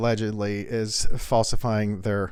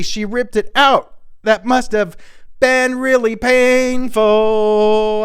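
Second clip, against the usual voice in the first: high, slow, dopey mock voice; 8 semitones higher than usual. Comic voicing the footballer boyfriend, high slow and dopey. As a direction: play the boyfriend as a slow dimwit.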